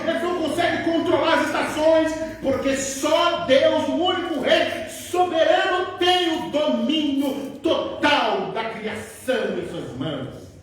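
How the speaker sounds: full-force sermon climax with a shout; fiery preaching. Acted. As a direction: thunder it from the pulpit, slowly.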